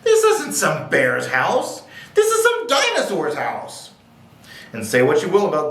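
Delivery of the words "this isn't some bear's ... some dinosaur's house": slow start leaping to a high exclamation; startled realization. A narrator's reading. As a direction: build from puzzled to alarmed discovery. Girl realizes whose house it is.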